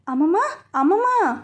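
high, anguished cry, half out of her mind; అమ్మమ్మ wailed high twice, each falling; desperate calling. A narrator's reading. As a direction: call after her as she collapses. Stumbling after grandma, crying out.

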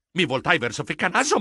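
ordinary delivery

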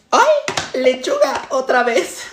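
high exclamation breaking into laughter; fake delight. Dieter finding lettuce at lunch again, fake delight. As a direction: put on delight at a dull lunch.

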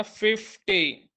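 Fifty drawn out slowly, fif pressed hard; slow stress on FIF. Shown as a demonstration. Teacher repeating fifty slowly, stressing fif. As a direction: stretch fifty and lean on fif. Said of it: "గట్టిగా ఒత్తి పలుకుతున్న ఫిఫ్ అని".

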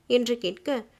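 ordinary delivery, nothing marked

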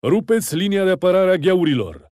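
high, loud battle command; urging on troops. A narrator's reading. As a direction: drive the troops into the attack. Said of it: "îmboldindu-și enicerii". (comandă de luptă strigată)

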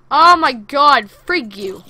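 loud, high startled outburst; startled shout. Real, not acted. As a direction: shout out from a sudden scare. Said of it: "You scared the absolute crap out of me".

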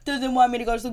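exaggerated woe-is-me imitation; mock crying victim. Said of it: "You crying victim".

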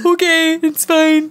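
high, strained fake-cheerful okay; forced okay. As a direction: pretend it's fine through panic. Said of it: "And I was like".

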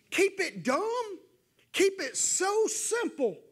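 a touch of sarcasm; sarcastic mock advice. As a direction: needle them with fake advice.

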